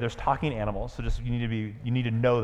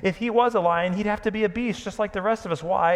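higher and louder, lofty lecturing; superior tone; 7 semitones higher, 4 dB louder than usual. Acted. Proud horse Bree scoffing at Aslan, superior. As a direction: explain it as if to a small child. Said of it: "in a rather superior tone".

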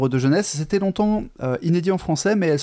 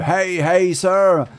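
higher, louder hammed-up pirate hail; a playful pirate shout, much louder and higher; pirate imitation; 6 semitones higher, 5 dB louder, much slower than usual. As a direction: hail them like a cartoon pirate. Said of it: "imitations du langage de pirate".

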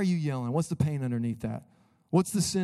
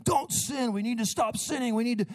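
high, scolding preacher; mock preachy scolding; preachy yelling; 9 semitones higher than usual. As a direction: lecture people about sin. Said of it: "people feel like you're yelling at 'em".

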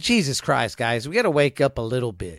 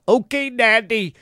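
put-on higher, slow, simpering kid voice; hammed-up childish yes-man; mock obedient child; 7 semitones higher, slower than usual. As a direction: play the meek little kid who swallows everything. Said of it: "We're going".

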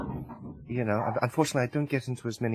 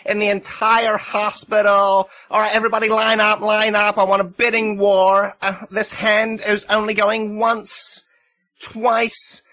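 booming auctioneer nurse; brassy loud nurse; 6 semitones higher, 7 dB louder than usual. Acted. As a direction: hawk it like a brash auctioneer. Nurse auctioning herself loudly.

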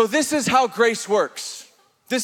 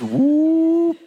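whoop; 6 semitones higher than usual. Acted. Jesus rising into the sky.

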